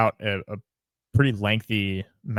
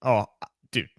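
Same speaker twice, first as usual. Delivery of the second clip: ordinary talk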